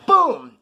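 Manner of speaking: loud vocal boom; sudden impact noise. Retold or imitated. Storyteller mimicking the ice-pick hit, explosive boom. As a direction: burst out with a punchy boom.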